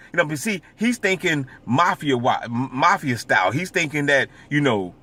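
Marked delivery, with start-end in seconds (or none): none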